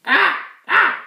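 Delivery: loud, high crow squawks; two piercing caws. Acted. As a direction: caw out sharply like a crow. Imitates a crow cawing.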